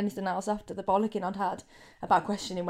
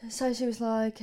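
ordinary talk, nothing marked

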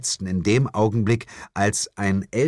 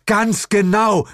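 higher, slower, louder agreement; high loud cry; shouting; 12 semitones higher, 5 dB louder, slower than usual. A narrator's reading. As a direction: yell it in alarm. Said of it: "schrie ich".

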